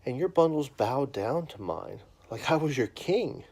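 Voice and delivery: put-on loud, lively storybook reading to a child; reading to children. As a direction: read it big and animated for the kids. Reads a children's Bible story.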